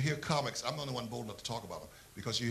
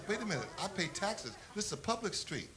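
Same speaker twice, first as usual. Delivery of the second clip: retelling his own indignation, pitched higher; playfully affronted taxpayer; higher indignant rant at a street sign; mock outrage; 9 semitones higher than usual.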